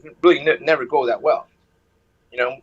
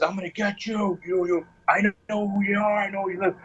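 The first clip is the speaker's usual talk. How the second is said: menacing entity voice; 4 semitones higher, 3 dB quieter than usual. Retold or imitated. Caller mimics the voice threatening him, eerie menace. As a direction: imitate a taunting spook, stretching the words. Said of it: "And it says".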